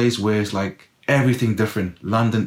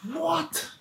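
high, breathy, much softer "What?"; stunned disbelief; 10 dB quieter than usual. Retold or imitated. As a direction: squeak out the shock of being picked. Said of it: "I'm like".

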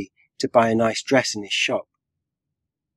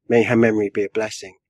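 plain reading voice

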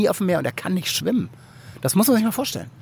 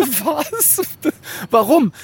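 high, sudden shocked "what"; shocked disbelief; 9 semitones higher, much slower than usual. Retold or imitated. Hearing Chandon is still at the harbour, stunned high disbelief. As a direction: blurt it out in disbelief.

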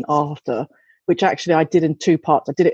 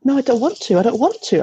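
higher, mildly whiny child imitation; reluctant young daughter; 5 semitones higher than usual. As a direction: mimic a little girl digging in. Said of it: "She's just a bit like".

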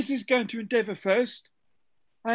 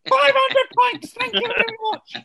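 excited announcer; 9 semitones higher, 6 dB louder than usual. Acted. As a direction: announce the prize like a host. Quizmaster announces bonus award.

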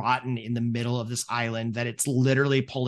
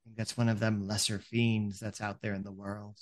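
wary ferryman; 3 semitones lower than usual. Acted. Ferryman warning friends about a fiend, wary. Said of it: "you hear the ferryman go".

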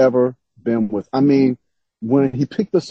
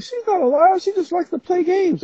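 much higher-pitched mock cousin voice; imitating cousin. Cousin defending a relative against being called a liar, high-pitched imitation. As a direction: put on a high voice for a protesting cousin. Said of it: "my cousin was like".

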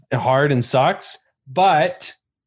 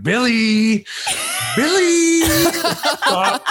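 fired-up shouting; 12 semitones higher, much slower than usual. Retold or imitated. Naked roommate yelling at his drunk friend, high laughing mock shout. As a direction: shriek at your friend to stop, half laughing. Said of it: "really getting fired up".

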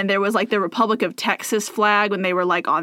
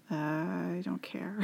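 whispered; 3 semitones lower, 15 dB quieter, slower than usual. Real, not acted. Shrugging guess, whispered.

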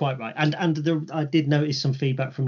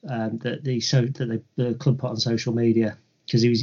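ordinary talk

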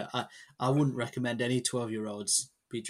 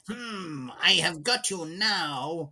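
high, slow, sinister villain sneer; cartoon villain voice; 5 semitones higher, slower than usual. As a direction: gloat like an eighties cartoon baddie. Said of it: "cartoon villain voice".

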